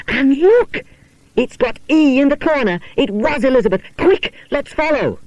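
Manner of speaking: high, eager discovery; breathless with the find; excited. Acted. Janet finds the handkerchief.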